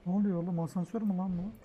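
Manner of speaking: ordinary talk, nothing marked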